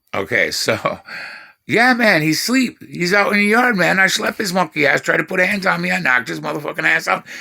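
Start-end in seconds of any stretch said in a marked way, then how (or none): 1.7-7.3 cocky bragging. Retold or imitated. Teenage son telling mom he knocked dad out, cocky and high. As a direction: brag it out proudly.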